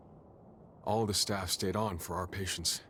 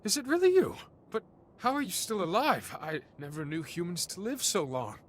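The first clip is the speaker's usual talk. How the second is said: astonished; 9 semitones higher than usual. Acted. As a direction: cry it out as if seeing a ghost. Stunned reunion after many years.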